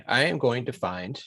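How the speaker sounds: normal talking voice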